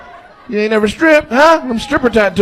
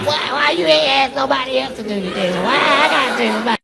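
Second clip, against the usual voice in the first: put-on high, whiny teen protest, softer; bad teenager; 7 semitones higher, 6 dB quieter than usual. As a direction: whine like a teenager refusing chores.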